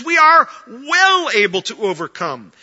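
WELL drawn out and loud; slow down and lean hard on "well"; stress on WELL. Acted. Caleb trusting God, emphatic loud stretched "well". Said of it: "repeated back to back for emphasis".

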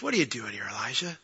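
low, soft question; still small voice. Acted. God asking Elijah again at the cave, gently. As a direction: ask it gently, dropping low. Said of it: "Then he heard the still small voice".

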